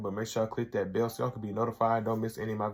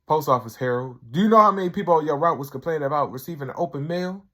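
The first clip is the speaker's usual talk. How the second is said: loud, higher, fast retold rant; mock angry rant; 5 semitones higher, 7 dB louder, faster than usual. Harold ranting at Tyrone about being fired, loud fast mock anger. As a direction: tear into him, hammed up. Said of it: "He said".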